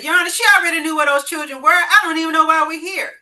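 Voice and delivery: loud, fast, indignant complaint; angry protest. Retold or imitated. Ex-husband protesting to the judge, angry and loud. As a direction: bark it at the judge, fed up.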